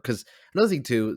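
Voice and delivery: usual speaking voice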